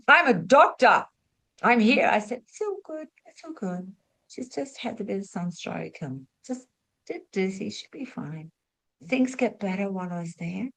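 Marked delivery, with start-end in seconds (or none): 0.1-2.1 louder, urgent doctor arriving; urgent announcement. Retold or imitated. Doctor racing over to the fainting woman, urgent. 2.5-8.5 soft, calm reassurance; reassuring. Retold or imitated. Nurse calming the doctor and crowd, soft reassurance.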